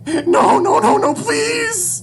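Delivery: very high, very loud plea; terrified scream. Acted. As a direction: scream in full panic, begging. Child screams as rifle rises.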